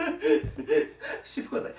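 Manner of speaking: laughing. Real, not acted.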